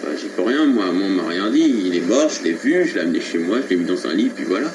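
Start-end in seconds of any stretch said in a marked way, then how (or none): none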